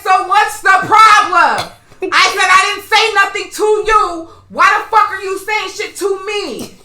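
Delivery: high, loud, slow and emphatic; angry confrontation. Retold or imitated. Rider standing up to confront the woman, loud and angry. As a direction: retell squaring up, stretching the words loudly. Retold confrontation after getting up.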